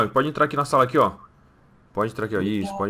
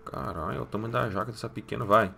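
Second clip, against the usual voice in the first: softer, lower, gaping aside; awed aside; 4 semitones lower, 9 dB quieter than usual. Acted. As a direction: murmur it so she can't hear. Soldier's awed aside behind her. (soldado comenta baixinho, espantado, atrás dela)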